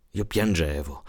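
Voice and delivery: usual speaking voice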